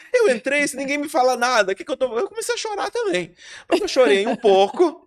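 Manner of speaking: chuckling at his own tears; laughing while telling. Real, not acted. Pastor laughs recalling crying with the couple, laughing.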